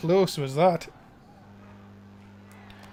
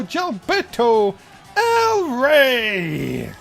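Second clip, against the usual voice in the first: ring announcer; 4 dB louder, much slower than usual. Acted. Ring announcer introducing wrestler.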